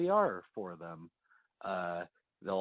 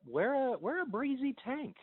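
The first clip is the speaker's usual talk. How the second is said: high playful; 11 semitones higher than usual. Real, not acted. Playful advice.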